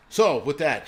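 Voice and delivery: usual speaking voice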